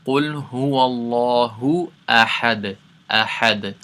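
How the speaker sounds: chanted tajweed recitation; Quran recitation. Shown as a demonstration. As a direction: recite the verse with a bounced final D. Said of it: "we'll do the whole ayat again".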